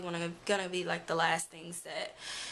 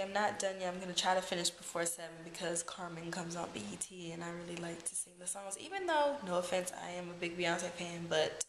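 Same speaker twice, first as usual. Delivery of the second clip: ordinary talk, nothing marked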